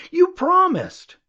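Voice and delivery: high, slow, drawn-out whine of protest; pleading complaint. Retold or imitated. One quarreler reproaching another for a broken promise, whining. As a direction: stretch it out like a wronged child.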